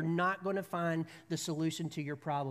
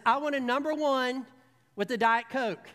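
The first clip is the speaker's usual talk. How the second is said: raised voice, pitch up and louder; louder order; 4 semitones higher, 6 dB louder than usual. Retold or imitated. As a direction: order louder since nobody answers. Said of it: "Her volume goes up".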